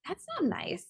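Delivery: high, soft mock scolding; gentle self-reproach. Answering her own inner critic, gently reproachful.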